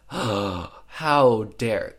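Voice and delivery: loud, indignant how dare; mock outrage. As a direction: act scandalised, hammed up. Barb finds Jackson cheating.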